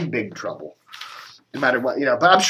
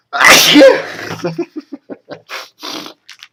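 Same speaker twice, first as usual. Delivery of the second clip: sneeze; 13 semitones higher, 10 dB louder than usual. Real, not acted. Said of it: "I'm gonna sneeze now".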